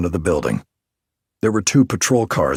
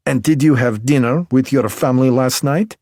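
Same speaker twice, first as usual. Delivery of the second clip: put-on higher, louder Belarusian-accented woman, sharp and teasing; 7 semitones higher, 5 dB louder than usual. A narrator's reading. M.E. technician Alina needles the detective in Belarusian accent.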